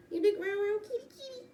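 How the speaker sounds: very high squeaky coo at the kitty. Real, not acted. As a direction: sweet-talk the cat, way up high. Baby talk to the cat.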